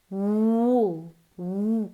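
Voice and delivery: Wool with a rounded oo, like Spanish búho. Shown as a demonstration. Teacher models Wool by comparing it to búho. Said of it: "Como un búho".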